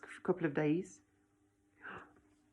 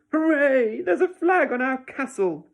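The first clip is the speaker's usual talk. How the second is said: loud, high hooray; cheering; 7 semitones higher, 8 dB louder than usual. A narrator's reading. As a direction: cheer like a proud dad on the beach. Dad cheers his sandcastle flag.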